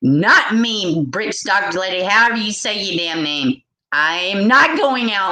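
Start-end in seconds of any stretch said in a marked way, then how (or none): none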